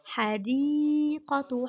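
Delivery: "حديقة" with a long, held ee in the middle; "حديقة" slowed and drawn out on the ya; lengthened madd letter. Shown as a demonstration. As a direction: stretch "حديقة": hadiiii-qatu. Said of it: "حرف المد هنا هو".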